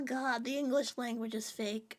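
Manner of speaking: normal talking voice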